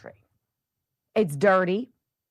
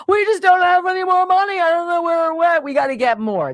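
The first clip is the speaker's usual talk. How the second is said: higher, louder whiny official voice; mock postmaster; 9 semitones higher, 4 dB louder than usual. Mocking the postmaster pleading with Congress. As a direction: play the helpless bureaucrat. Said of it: "said with a straight face to Congress".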